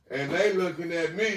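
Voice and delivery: ordinary delivery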